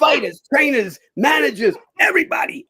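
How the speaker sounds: high loud listing shout; imitated shout. Director demanding every Italian boxer, high loud shout. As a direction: bellow the whole list.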